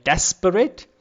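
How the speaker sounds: "desperate" slowly, with "des" punched; stress on first syllable. Shown as a demonstration. Lecturer showing a dactyl, slow "desperate" stressed on its first syllable. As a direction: hit "des" in "desperate", rest unstressed. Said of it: "first syllable is stressed".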